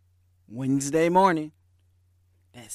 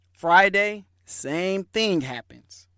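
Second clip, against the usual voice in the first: ordinary talk, nothing marked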